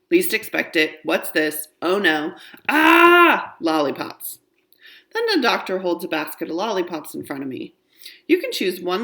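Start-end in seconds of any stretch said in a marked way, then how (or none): 2.7-3.5 high, loud, drawn-out cry; exclaimed "ah". A narrator's reading. Child reacts with a cry.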